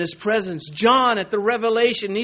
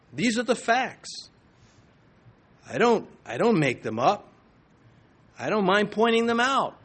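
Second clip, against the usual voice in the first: ordinary talk, nothing marked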